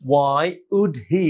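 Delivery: very slow "why would he?", each word apart; slow, separate words. Shown as a demonstration. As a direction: stretch every word. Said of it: "হয়ে যাচ্ছে উডি". (ধীরে, আলাদা করে)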